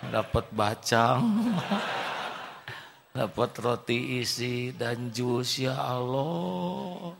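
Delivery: slow, laughing, drawn-out thanks; laughing amazement. Acted. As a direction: marvel at the gifts through a laugh. (takjub sambil tertawa)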